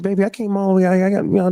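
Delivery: mock her gushing over the phone, voiced high; imitating the woman. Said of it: "She's like".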